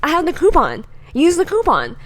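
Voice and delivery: loud, high insistent dad demanding the coupon; indignant dad. Retold or imitated. Dad insisting to the salesperson, loud and indignant. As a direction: insist hard like an angry customer. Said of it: "And he's like".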